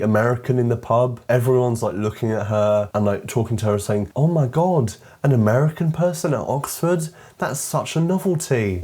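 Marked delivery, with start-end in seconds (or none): 4.1-8.8 high, hammed-up astonishment; mock amazement. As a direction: gush as if marvelling at a rarity. Mimics amazed pub crowd.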